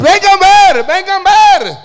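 high loud call into a microphone. Retold or imitated. Young preacher calling passers-by, loud high shout. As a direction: summon the crowd, loud and high.

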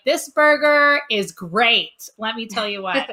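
slow, loud pretend shout; playful slow shout of praise for the burger; mock yell. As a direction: bellow it across the restaurant, drawn out. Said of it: "I'm just gonna yell it out".

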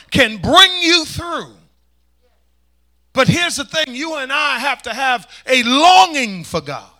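loud, high, stretched key words; full-force preaching. Real, not acted. Preacher urging the congregation to long for God, forceful preaching.

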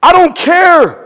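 loud, high, shouting sermon; high and loud, driving the point home; full-force preaching. Acted. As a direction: preach to the rafters.

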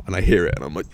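ordinary delivery

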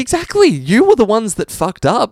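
loud, high, laughing indignation; mock outrage. Host blaming the English for convicts, comic outrage. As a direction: rant at them through laughter.

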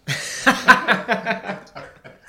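breathy burst of laughter; big hearty laugh; loud laugh. Real, not acted. Laughs at own joke.

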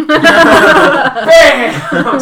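loud laughing sound effect; excited bam. Acted. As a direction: punch out the impact with glee. Narrating the wrestling throw.

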